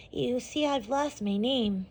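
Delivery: plain reading voice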